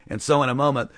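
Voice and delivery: ordinary delivery, nothing marked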